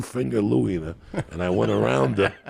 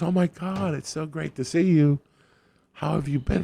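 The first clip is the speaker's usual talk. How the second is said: high, bright gushing greeting; gushing surprise; 8 semitones higher than usual. Retold or imitated. Woman delighted to run into her delivery guy, gushing. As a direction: greet him like a long-lost friend. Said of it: "she goes".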